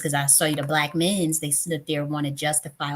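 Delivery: normal talking voice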